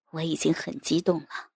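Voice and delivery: usual speaking voice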